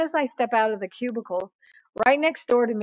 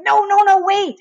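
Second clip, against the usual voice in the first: high, loud rush to stop him; re-enacted alarmed interruption; urgent protest; 9 semitones higher, 8 dB louder than usual. Stopping a transfer on phone.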